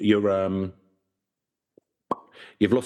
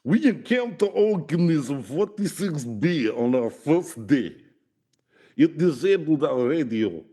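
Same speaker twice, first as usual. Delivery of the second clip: put-on Russian accent; 6 semitones higher than usual. Reading the doctor's account to co-host, hammed-up Russian accent. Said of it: "I can do a Russian accent".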